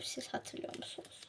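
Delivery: hushed whisper to viewers. Real, not acted. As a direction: breathe the question secretly. (fısıltıyla sorulan soru)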